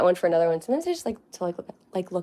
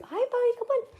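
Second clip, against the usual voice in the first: pet voice. Real, not acted. Calling the bunny.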